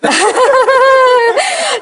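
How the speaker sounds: high, drawn-out laugh; long burst of high laughter. Real, not acted. Teacher to a participant who interjected, long amused laugh.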